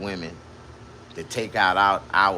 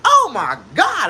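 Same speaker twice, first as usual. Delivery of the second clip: high, louder, slow moaning cry; mock ecstasy; 9 dB louder, slower than usual. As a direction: wail it out in falsetto. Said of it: "I was like".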